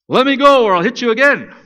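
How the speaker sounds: high, loud furious cry; furious yell. Retold or imitated. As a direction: shout it while stuck fast and struggling. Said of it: "he says".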